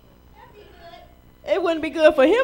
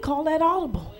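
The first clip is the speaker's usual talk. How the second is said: quiet; 2 semitones lower, 6 dB quieter than usual. Shown as a demonstration. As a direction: call that audible said softly. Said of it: "what Pastor Rick taught us".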